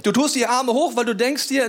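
ordinary talk

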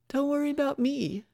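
high-pitched, confident character voice; self-assured Dimple, reassuring. Acted. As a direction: brush off the worry in a high voice.

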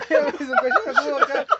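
high laugh spilling into words. Real, not acted. Recalling slipping on juice at school, high laughing. Laughing at his own fall.

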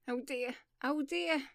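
alarmed, drawn-out lament; fearful cry. Acted. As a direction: cry out in sudden fright. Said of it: "suddenly cried with a face of fear".

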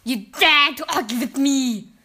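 loud, outraged witch challenge; screamed threat. A narrator's reading. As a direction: roar at the underling as an offended witch. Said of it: "screamed the Grand High Witch".

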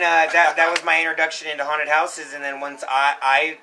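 usual speaking voice

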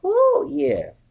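high, loud cheering whoop; excited whoop. Real, not acted. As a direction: whoop it out with joy. Cheering about weekly pay.

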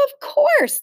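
high, bright-idea exclamation; sudden realization. A narrator's reading. Mother works out the locked door, sudden realization. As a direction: pipe up as it dawns.

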